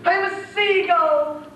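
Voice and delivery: mock-tragic princess, laughing; imitating the princess. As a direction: parody her tragic sighs. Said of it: "You imitated her".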